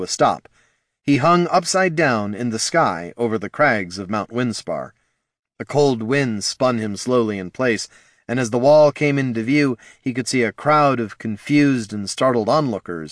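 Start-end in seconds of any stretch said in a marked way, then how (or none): none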